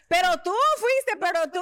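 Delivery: high, slow, dramatic accusation; mock-dramatic accusing voice, pitched high and dragged out; mock accusing friend. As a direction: ham up the friend's blame.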